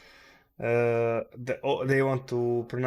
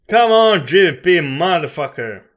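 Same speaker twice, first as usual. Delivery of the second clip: loud, higher angry yell at the screen; furious shout; 6 semitones higher, 15 dB louder than usual. Real, not acted. Trader curses the pound chart, a loud furious shout. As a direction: bellow curses at the currency.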